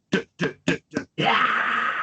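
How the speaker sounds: beatbox the guitar intro; imitating the album opening riff; imitated riff. Shown as a demonstration. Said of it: "It's literally just".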